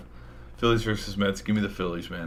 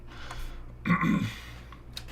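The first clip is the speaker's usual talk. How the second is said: throat clearing; 6 dB quieter than usual. Real, not acted. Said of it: "my voice is giving".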